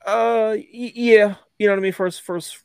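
the yes catches in a choked throat; voice breaking. Real, not acted. Choking up as the co-host asks about his son. Asked about a painful first loss.

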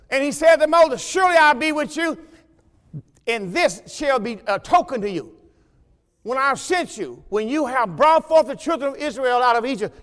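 preached scripture; proclaiming promise. Acted. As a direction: proclaim it to the church.

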